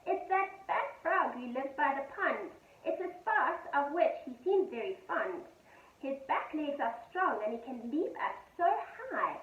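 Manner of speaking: usual speaking voice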